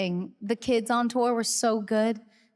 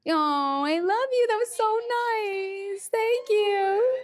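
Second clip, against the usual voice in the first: very high, sweet gush; gushing thanks; 11 semitones higher, slower than usual. Real, not acted. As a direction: coo your thanks, pitched way up.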